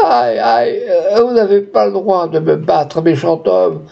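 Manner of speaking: theatrical lament between sobs; sobbing wail. A narrator's reading. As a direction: moan and protest in a high tearful voice. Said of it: "entre des soupirs et des sanglots".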